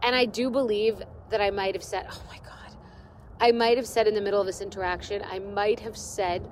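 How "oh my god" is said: breathless whispered aside; embarrassed whisper. Real, not acted. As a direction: whisper it, mortified at yourself. Mortified aside mid-story.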